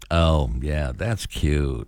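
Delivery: drawn-out, low, patronizing brush-off; low, condescending pat on the head. Shown as a demonstration. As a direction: say it like humoring someone beneath you. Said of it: "phrases that can sound condescending".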